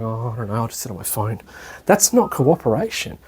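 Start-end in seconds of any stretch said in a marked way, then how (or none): 0.0-1.4 much softer, lower mumble of a bored guest; a mild mock shrug of a phone addict; muttered lazy guest; mock disinterest.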